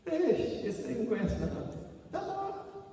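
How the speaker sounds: acted derisive chuckle; mock scoffing. Preacher playing mourners who doubt Jesus, mocking chuckle. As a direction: scoff through a small laugh.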